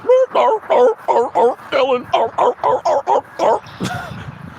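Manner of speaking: high barking seal imitation; seal noise. Imitating the audience as clapping seals, high honks.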